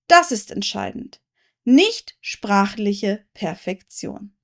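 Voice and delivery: normal talking voice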